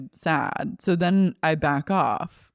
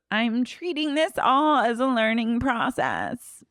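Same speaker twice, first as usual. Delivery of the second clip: higher-pitched quoting voice; mimicked excuse; 6 semitones higher than usual. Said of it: "Rock goes".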